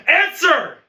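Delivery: explosive high, loud command; one-word bellow; furious shout. Acted. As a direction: scream for an answer. Teacher demands an answer.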